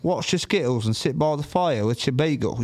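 put-on British accent, matter-of-fact. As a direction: finish the ramble in mock British. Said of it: "to talk British, you know what I mean?".

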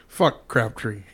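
normal talking voice

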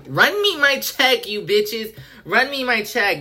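high, sassy demand; demanding payment. Pretend. Asking Doctor Pepper for money, high and sassy. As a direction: playfully demand the sponsor pay up. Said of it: "The amount of times I've mentioned Doctor Pepper".

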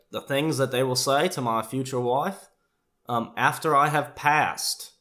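solemn declaration in a lifted voice; Michael's earnest voice. Retold or imitated.

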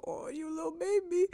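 high soft cooing voice; cooing baby talk. Retold or imitated. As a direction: coo at a baby, softly. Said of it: "and I just go".

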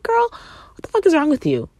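high, loud exasperated disbelief; mock outraged sass; incredulous girl. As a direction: throw it at her, appalled. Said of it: "I was like".

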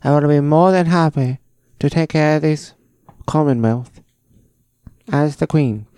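put-on high, projected young royal voice; Queen Elizabeth. Shown as a demonstration. Host impersonating the young queen's address, high regal British voice. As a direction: declare it as a young British monarch. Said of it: "Queen Elizabeth when she was twenty-seven years old talking".

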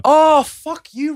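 high drawn-out outraged oh; mock outrage. Real, not acted. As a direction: stretch the oh in mock shock.

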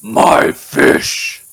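loud, high orc bellow; hammed-up orc yell; orc shout. Orc player shouts in character.

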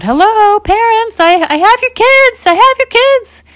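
high, loud sing-song call across the woods. Acted. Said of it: "she was calling out".

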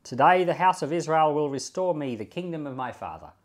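measured, formal Bible reading; a pastor reading the chapter aloud to his flock, steady and formal; scripture reading. Acted. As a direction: read the passage aloud to the congregation. Reads the scripture passage aloud.

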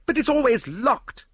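high, loud objection; disbelieving protest. A narrator's reading. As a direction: protest it, voice shooting up.

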